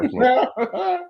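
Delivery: high-pitched, laughing insistence; breaking into laughter. Real, not acted. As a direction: give in to the giggles. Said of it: "You can't even say it without laughing".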